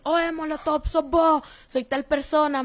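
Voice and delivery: high, eager call of surprise; excited recognition. Retold or imitated. Fellow prisoners recognizing Molotov through the bars, high excited calls. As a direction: call out as if spotting an old friend. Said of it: "todos me empezaron a decir".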